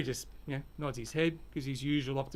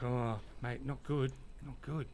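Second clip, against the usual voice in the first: softer, downcast, a bit slow; glum reply; 7 dB quieter, slower than usual. Acted. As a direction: mumble the bad news gloomily. Harry's bad news in joke.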